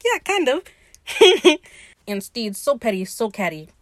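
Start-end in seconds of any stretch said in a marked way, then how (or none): 0.0-0.6 very high mock-coy answer; petty reply. Retold petty reply of Steed. 1.1-1.6 loud, high-pitched giggle; laugh. Real, not acted. Laughs at own retelling.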